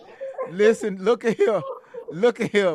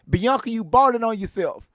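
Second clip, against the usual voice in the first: normal talking voice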